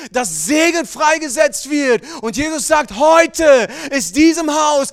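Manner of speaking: loud, high, rising sermon call; booming revival preacher; full-force preaching. Acted. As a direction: preach it out with fire to the congregation.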